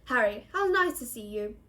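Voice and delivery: stiffly ceremonious welcome; solemn, pompous. A narrator's reading. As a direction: greet him as if never introduced. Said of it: "held out his hand solemnly".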